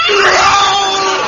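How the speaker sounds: a high, loud bellowed order; bellowed roll call. Acted. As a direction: bark it out to the whole class.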